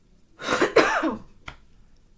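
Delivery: loud rough cough. Real, not acted. Coughing fit, then apologizes.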